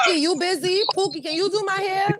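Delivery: high, sweet-talking plea to a cousin; mock pleading request. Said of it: "You said".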